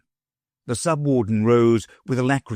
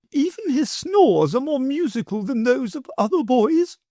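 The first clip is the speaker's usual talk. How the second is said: high, gushing noblewoman voice; doting grand lady. A narrator's reading. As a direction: boast fondly about the boy.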